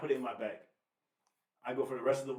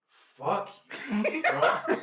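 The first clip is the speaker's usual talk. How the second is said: laughing curse; 8 semitones higher, 6 dB louder, much slower than usual. Retold or imitated. Retelling stressful airport interrogation.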